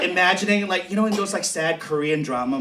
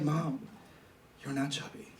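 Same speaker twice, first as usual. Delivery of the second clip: deadpan put-down; 5 dB quieter than usual. Retold or imitated. Imagined daughter telling her mom the truth, soft and deadpan. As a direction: drop it quietly like a blunt truth. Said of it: "look at her and be like".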